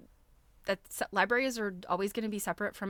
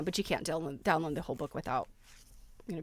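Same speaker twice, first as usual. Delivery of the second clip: ordinary delivery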